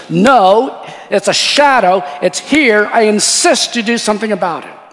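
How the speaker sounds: loud, high, urgent retold demand; acted insistence. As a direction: insist forcefully, imitating an alarmed girl. Girl insists the pastor act.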